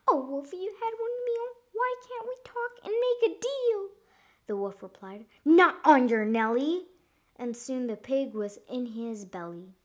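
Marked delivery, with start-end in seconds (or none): 0.1-3.9 very high piggy squeal; squeaky storybook piglet begging. A narrator's reading. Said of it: "The little pig began to squeal. He cried". 5.4-6.9 loud storybook wolf retort; lightly voiced wolf, firm and loud; refusing wolf. A narrator's reading. Wolf refuses pig's plea.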